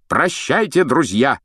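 громкий, высокий прощальный возглас; выкрикнуть прощание рыцаря на бегу; крик. Acted. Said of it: "крикнул рыцарь". (рыцарь кричит на прощание друзьям, громко и высоко)

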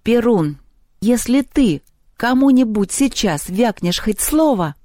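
iron-voiced threat. A narrator's reading. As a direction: space the words out as a threat. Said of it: "железным голосом сказала Люба".

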